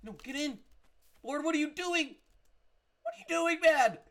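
loud, high scolding; exasperated yelling. Real, not acted. Player scolding his pet near skeletons, yelled in exasperation. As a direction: shout in disbelief at a wayward pet.

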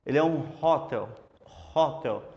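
"hotel" with a breathy h from the throat; aspirated h in "hotel"; throaty h. Shown as a demonstration. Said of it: "um H mais inspirado na garganta".

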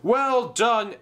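high, hearty congratulation; jubilant praise. Acted. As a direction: cheer the whole team. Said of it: "shouted Sir Topham Hatt".

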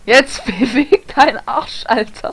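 loud and slow, laughing through it; laughing urge. Real, not acted. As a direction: laugh while telling him to hurry. Laughing at teammate, urging him. (lachend und laut, gedehnt)

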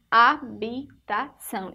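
HABITAÇÃO slowly split into four syllables. Shown as a demonstration. Teacher repeats the word for pupils to hear, syllable by syllable. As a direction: spell out HABITAÇÃO by sound, a-bi-ta-ção. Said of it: "Ó o som".

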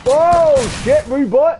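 loud, high-pitched outburst; excited shout. Real, not acted. Gamer reacting to sudden action in a match, excited shouting.

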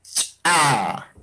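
"Cha" with a sharp popped ch, a tiny gap, then the vowel; popped ejective ch. Shown as a demonstration. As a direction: pop the ch of "Cha" apart from the a. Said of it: "Aquí".

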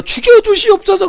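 high, pleading cry of a kneeling courtier; begging subject from a historical drama. Retold or imitated. A courtier begs the king for death in a historical drama, high pleading cry. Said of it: "사극 봐요".

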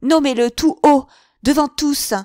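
very loud and high throughout; violent outburst. A narrator's reading. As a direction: hurl the accusation at him. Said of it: "reprit-elle avec une violence convulsive".

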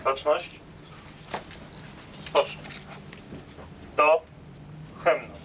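barked parade command; crisp military order; drill sergeant. Real, not acted. (komenda wojskowa)

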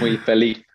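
Spanish ceceo on "feliz"; Castilian lisp. Shown as a demonstration. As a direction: say "muy feliz" with the Spain lisp, z as th. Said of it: "we say everything, like, with a lisp".

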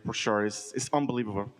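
normal talking voice

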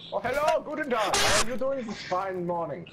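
put-on German accent. Greeting a teammate called Boston, in a cheery German accent. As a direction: greet them like a jolly German soldier.

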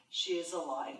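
normal talking voice

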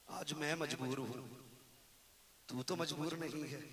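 soft, broken plea to the friend; tearful plea. Acted. Dying son softly begs his beloved friend to come. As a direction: hush the voice, pleading in sorrow.

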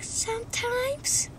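high, slow, soft; a spooky little girl answering the doctor; creepy possessed girl. Retold or imitated. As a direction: draw it out eerily. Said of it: "the girl's like".

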